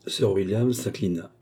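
ordinary delivery, nothing marked

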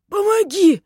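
very high, loud strangled plea; creaky, desperate squeal; creaking, choking cry. A narrator's reading. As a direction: squawk for help while choking. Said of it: "скрипел он".

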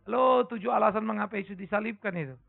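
ordinary talk, nothing marked

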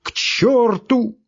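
very high, slow wail; tearful shout. A narrator's reading. Doctor runs out after his guests, tearful shout. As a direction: wail the curse after them, stretched out. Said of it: "крикнул он плачущим голосом".

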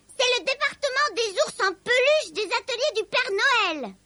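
high, sharp little elf voice; squeaky grumpy elf; disagreeable elf. A narrator's reading. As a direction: explain it in a high, peevish elf voice. The disagreeable elf explains the workshop.

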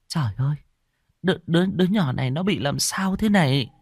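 put-on a mother panicking over a baby; alarmed cry. First wife Hoa sees the baby turn blue, frightened. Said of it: "bà cả Hoa kêu lên".

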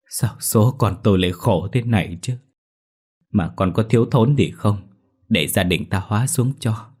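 a grieving mother asking through her sniffles; sniffling mother. A narrator's reading. Mother asks her dead son, mildly tearful. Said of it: "Bà Điểm sụt sùi nói".

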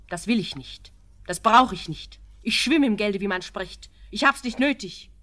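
lauter und höher, gekränkter Stolz; stolze Abweisung. Acted. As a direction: das Geld empört von sich weisen. Proud refusal of his money. (gekränkt und laut)

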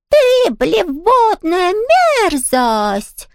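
slow, very high taunting cry; high falsetto cry. A narrator's reading. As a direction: break into falsetto for the insult. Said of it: "крикнул он высоким голосом".

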